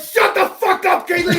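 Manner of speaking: high, loud and laughing; laughing mock yell. Telling colleague Keatley to shut up, laughing loudly. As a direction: yell it while cracking up.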